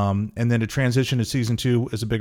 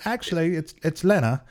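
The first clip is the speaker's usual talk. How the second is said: higher-pitched English woman correcting politely; light mock English accent, pitched up; 6 semitones higher than usual. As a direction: imitate an English actress making a correction. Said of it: "and she's English, I believe".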